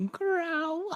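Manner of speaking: very high squeaky growl word. Shown as a demonstration. Streamer joking growl, said very high and squeaky. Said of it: "The male growl".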